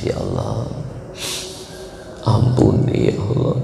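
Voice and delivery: weak, breaking mock sob of a plea; pretend crying. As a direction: fake a tearful plea, voice giving out. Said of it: "Kemudian kau pura-pura menangis". (pura-pura menangis lirih)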